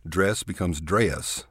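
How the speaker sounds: "dress" stretched with a lilt, "dre-ess"; Texas drawl. Shown as a demonstration. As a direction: drawl "dress" into two syllables. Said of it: "stretch our vowels out and put a lilt".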